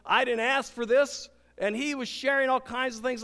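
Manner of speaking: ordinary delivery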